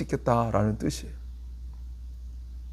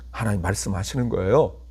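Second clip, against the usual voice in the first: ordinary delivery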